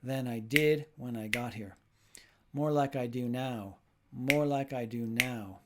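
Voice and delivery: slow, stressed "did", sing-song rhythm; teacher leading choral rhythm practice; rhythmic stress. Shown as a demonstration. Teacher to learners, leading slow rhythmic repetition. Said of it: "Snap your fingers with me".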